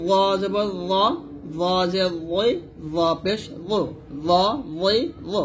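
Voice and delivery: "ল" as a thick, dark voiced th; tongue tip at upper teeth, fill out "ল" heavily; heavy Arabic zo. Shown as a demonstration. Teacher drilling learners on heavy zo, thick "লই". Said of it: "এরকম মোটা উচ্চারণ করতে হবে".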